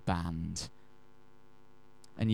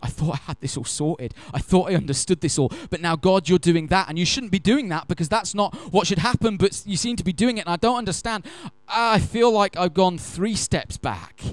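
rising, louder, exasperated protest; pleading with God, getting more worked up; frustrated complaint to God; 8 semitones higher, 6 dB louder than usual. Pretend. Congregant's frustrated inner voice to God.

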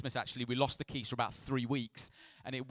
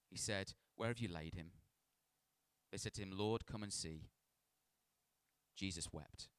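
measured, softer narration of a Bible story; scripture reading; 4 semitones lower, 9 dB quieter than usual. A narrator's reading. Preacher reads the raising of Lazarus to church, measured scripture reading. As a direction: read the passage solemnly and evenly.

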